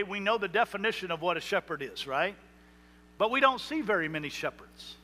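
ordinary talk

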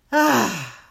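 big open sigh, letting the shoulders drop; loud audible sigh. Shown as a demonstration. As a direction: release it all in one loud exhale. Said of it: "And drop them with a loud sigh".

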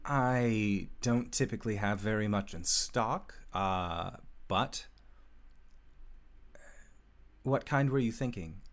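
awed elf smith talking to herself; elf smith Marissa, in awe. Acted.

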